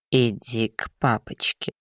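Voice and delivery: put-on low, cooing baby talk; lisping coo with menace underneath; fire demon. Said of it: "Просюсюкал беловолосый всё с теми же дебильными интонациями".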